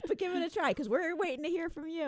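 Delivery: laughing through the words. Real, not acted. Laughing after the phone joke.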